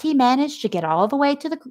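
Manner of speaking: usual speaking voice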